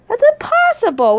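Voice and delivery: high-pitched, disbelieving protest; incredulous objection. Acted. One plushie rejects the cloning machine idea, incredulous.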